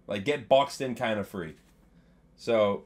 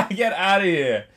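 1 laughing dismissal; 6 semitones higher, 6 dB louder than usual. Real, not acted. Laughing at chat trolls.

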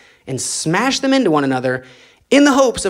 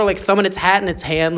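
ordinary delivery

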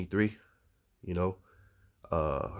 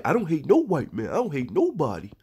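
higher, louder put-on voice of a self-hating man; mocking their denial; 6 semitones higher, 4 dB louder than usual. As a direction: play the man insisting he hates no one, pitched up. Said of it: "They start speaking like that".

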